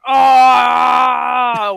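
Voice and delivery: long, very loud cat howl; imitated cat yowl. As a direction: yowl like a cat holding court. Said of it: "where he's just like".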